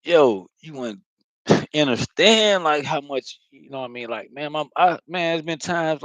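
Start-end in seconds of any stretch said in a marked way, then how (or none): none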